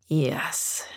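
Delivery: firm, understated tough refusal. A narrator's reading. Master refusing her plea, tough. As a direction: say it firmly, with authority. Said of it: "his voice tough".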